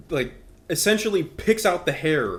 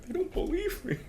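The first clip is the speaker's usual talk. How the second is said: high, whiny mock sob; mock crying kid; 5 dB quieter than usual. Liar kid crying to his mom in Russia, high mock whine. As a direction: whimper it like a wronged little boy. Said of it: "he's in Russia crying".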